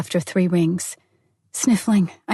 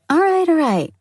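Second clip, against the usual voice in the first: high, louder, give-in; conceding; 10 semitones higher, 4 dB louder than usual. Acted. Friend gives in after teasing her, conceding loudly. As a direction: throw up your hands and give in. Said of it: "she conceded finally".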